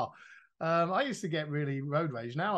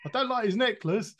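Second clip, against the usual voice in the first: higher and louder, blurting out "don't"; voicing a careless comment a bit louder; blurted-out thought; 6 semitones higher, 5 dB louder than usual.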